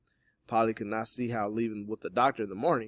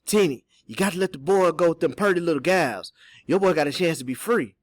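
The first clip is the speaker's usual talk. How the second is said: urgent, louder character voice; serious, higher-pitched plea; 8 semitones higher, 11 dB louder than usual. A narrator's reading. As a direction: press the point in earnest. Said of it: "His voice got serious then".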